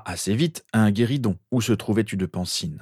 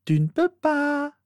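put-on very high, drawn-out girl's voice; assured sister; slower than usual. A narrator's reading. Sister assuring Harry he can't convince her, smug. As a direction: stretch it out high and sure of yourself. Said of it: "lui assura Rose".

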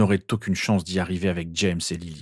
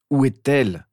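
higher, intense demand; slow intensity; 5 semitones higher, 4 dB louder than usual. A narrator's reading. Harry repeating his demand to his sister, intense. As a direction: press the question with weight. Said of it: "répéta-t-il lentement avec tellement d'intensité".

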